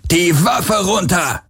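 guard shouting a command; shouted order. A narrator's reading. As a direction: bark the order across the garden. Guard orders them to drop weapons.

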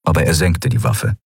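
usual speaking voice

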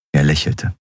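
ordinary delivery, nothing marked

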